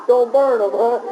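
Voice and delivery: words bubbling with laughter; chuckling while joking; laughing. Real, not acted. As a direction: laugh through the tease. Teasing joke about burning papers.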